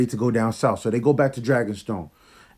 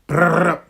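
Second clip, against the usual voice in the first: louder rattling brrrr; launcher noise; 4 semitones higher, 6 dB louder than usual. Retold or imitated. Imitating Euron's launcher firing, a louder brrrr rattle. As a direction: mimic spears firing.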